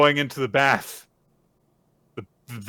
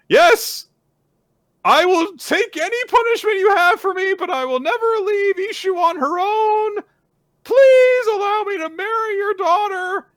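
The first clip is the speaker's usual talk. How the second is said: very high, loud impassioned plea; acted overwrought begging; desperate shouted vow; 9 dB louder than usual. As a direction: cry out the vow at full force. Suitor begs to marry her.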